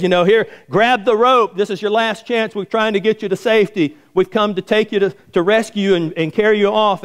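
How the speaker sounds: high-pitched urgent appeal; acted rescuer's announcement; retold loudspeaker call. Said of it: "the guy speaks over the loudspeaker".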